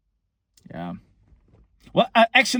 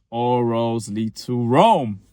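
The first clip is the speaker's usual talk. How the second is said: All roads lead to Rome drawn out slowly; patient, deliberate repeat; slow insistence; 4 dB louder, much slower than usual. Acted. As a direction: spell it out word by word. Repeating the phrase to her.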